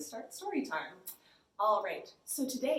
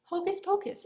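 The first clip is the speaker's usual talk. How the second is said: high, loud playful spell; magic words; 9 semitones higher, 5 dB louder than usual. Real, not acted. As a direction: announce it like a magician. Reading book title aloud.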